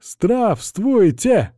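high, drawn-out group bark; barked greeting. Acted. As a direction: bark it like soldiers. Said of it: "гаркнули".

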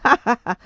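loud, bitter laugh; short mocking burst of laughter; laugh in her face. A narrator's reading. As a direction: laugh harshly at the listener. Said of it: "E mi rise sulla faccia".

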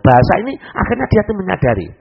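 ordinary talk

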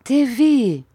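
put-on high call of the child's name; mother calling. A narrator's reading. Mother to her hidden, crying son, calling out high.

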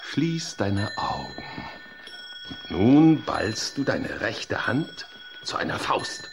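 low, slow, calm instructing voice; relaxation guidance. Acted. Therapist talking Rudolf through relaxation, low and slow. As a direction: ease him into relaxing, slowly.

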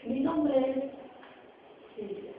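a long pause between "Mi nombre es" and "Silvia"; pause inside the sentence. Shown as a demonstration. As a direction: say "Mi nombre es", stop, then "Silvia", falling. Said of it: "Y yo digo".

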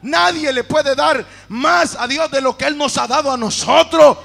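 very loud, rising doxology; booming pulpit climax of praise; impassioned preaching. Real, not acted. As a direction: preach it at full force. Climax of sermon, praising God.

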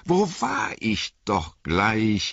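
slow, searching for the thread; musing slowly. A narrator's reading. Innkeeper trying to remember, musing slowly. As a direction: drag it out as if he has lost his place. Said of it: "haschte fingerschnalzend nach seiner Erinnerung".

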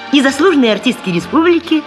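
ordinary talk

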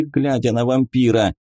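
ordinary delivery

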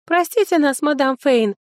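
butter her up sweetly. A narrator's reading. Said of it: "как можно более милым голосом".

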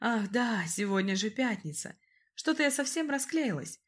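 ordinary delivery

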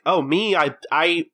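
high, stammering fake innocence; mock evasive. Customer asked why he is here, mock-evasive. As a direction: play caught out and dodging the question. Evasive answer when caught there.

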